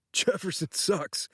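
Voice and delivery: words hidden in a fake cough. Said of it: "through a fake cough".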